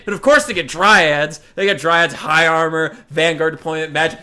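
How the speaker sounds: ordinary talk, nothing marked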